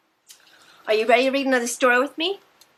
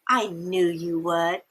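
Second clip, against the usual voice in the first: put-on knowing old man; 5 semitones lower, 3 dB quieter than usual. A narrator's reading. Mister Carp answers the boy.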